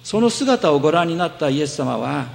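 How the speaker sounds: normal talking voice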